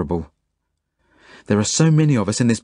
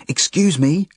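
high-pitched call to get attention; yell; 8 semitones higher, slower than usual. A narrator's reading. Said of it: "I yell at the Nazi officer".

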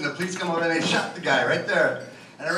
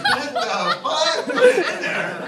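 much higher, louder disbelieving cry through a laugh; shocked outburst; 12 semitones higher, 5 dB louder, slower than usual. Retold or imitated. Shocked reaction quoted.

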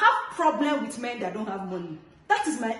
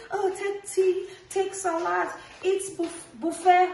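mocking quote; 7 semitones higher, slower than usual. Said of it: "Do not come and say".

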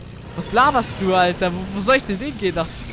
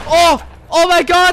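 much higher and louder, slow and stretched; real shock, drawn out and loud; stunned outcry after a knock; shocked exclamation; 8 semitones higher, 10 dB louder, slower than usual. Real, not acted.

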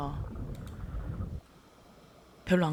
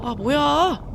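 startled; 7 semitones higher, 6 dB louder, much slower than usual. Real, not acted. Sudden shark scare.

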